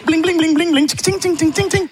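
high mimicked coin jingle "bling" and "tching"; sound effects. Shown as a demonstration. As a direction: make cash-register noises with the voice. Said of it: "faire des bruitages".

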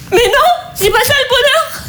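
monte de plus en plus haut en riant et pleurant; elle craque, mi-rire mi-sanglot; rire qui vire aux larmes. Acted. (la sœur épuisée craque devant sa cadette, en riant et pleurant)

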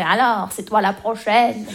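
slow, lightly voiced joking granny; a slowed-down hint of a needling grandmother; teasing grandmother. Grandmother teasing her granddaughter at every wedding, lightly mocking. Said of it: "ma grand-mère qui me fait la même blague".

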